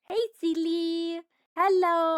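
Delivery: high, drawn-out greeting; cute pet voice. Retold or imitated. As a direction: voice a little cat saying hello, high and slow. Voices the approaching cat greeting her.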